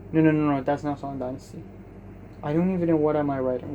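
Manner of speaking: usual speaking voice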